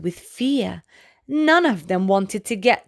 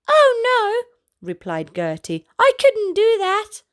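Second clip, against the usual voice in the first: high giraffe voice; 11 semitones higher than usual. Acted. Gertie the giraffe praising Alfie, high and admiring.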